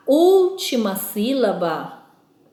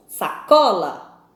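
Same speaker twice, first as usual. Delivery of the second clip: "Sacola" very slowly, leaning on "co"; "Sacola" drawn out, middle syllable strong; stress on second-to-last syllable; 3 semitones lower, 3 dB louder, much slower than usual. Shown as a demonstration. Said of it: "a penúltima sílaba é a tônica".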